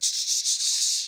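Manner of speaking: loud, repeated hissing "shh"; rhythmic mouth-made sawing effect; imitated cutting sound. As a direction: imitate slicing with bursts of hiss.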